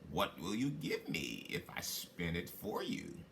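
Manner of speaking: sly little man haggling quietly. Acted. Little man bargains with maiden.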